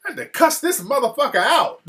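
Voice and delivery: high-pitched indignant venting; retold loud anger. Wife venting to her husband, retold loud anger. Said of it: "She was talking all loud".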